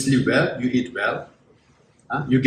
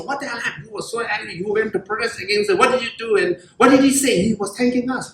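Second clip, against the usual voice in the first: higher-pitched acted bewilderment; baffled disbelief; 4 semitones higher, faster than usual. Protesters asking each other why he thanked them, baffled disbelief. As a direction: play puzzled protesters, voice pitched up.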